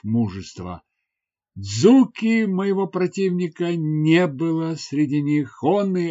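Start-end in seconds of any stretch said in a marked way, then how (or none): none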